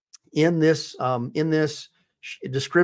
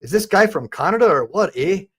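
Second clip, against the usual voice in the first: put-on teasing Canadian accent with oot and eh; lightly hammed Canadian accent, poking fun at the cop; mock Canadian accent; 2 semitones higher than usual. Mocking the cop who said oot, in a jokey Canadian accent.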